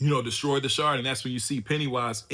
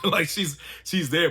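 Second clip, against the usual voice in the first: higher voice through a chuckle; laughing; 5 semitones higher, 4 dB louder, slower than usual. Real, not acted. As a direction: chuckle into the words. Amused by her hitting kids.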